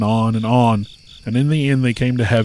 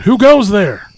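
high loud sentry challenge; calling out; 9 semitones higher, 9 dB louder, slower than usual. A narrator's reading. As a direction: bark the challenge from the gate. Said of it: "the devil doing sentry duty calls out".

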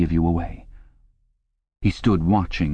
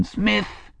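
shrewish scream. A narrator's reading. Shrewish telescreen voice singles out Smith, high mock scream. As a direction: call out sharply like a shrew. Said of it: "screamed the shrewish voice from the telescreen".

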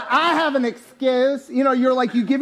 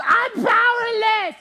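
mock whiny voice; 12 semitones higher, slower than usual. Mocking quoted self-talk.

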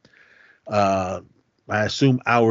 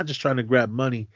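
ordinary talk, nothing marked

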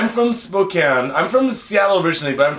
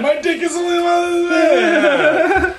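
mock shout; 12 semitones higher, slower than usual. Real, not acted. Joking high shout about size.

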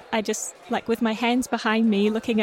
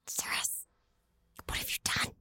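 whisper; 14 dB quieter than usual. Acted. Player whispering in character.

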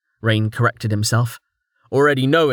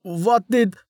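breathless, higher shocked gasp; a noble caught off guard; 10 semitones higher than usual. A narrator's reading. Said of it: "Lord Hakim gasped".

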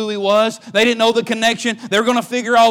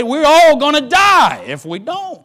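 urging father; 5 semitones higher, 4 dB louder than usual. Acted. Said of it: "he said".

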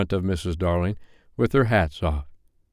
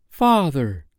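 higher-pitched cry of mild shock; 6 semitones higher than usual. A narrator's reading. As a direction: call out to father, taken aback.

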